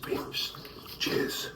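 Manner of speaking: whispering. Real, not acted. Whispered toast, baby asleep.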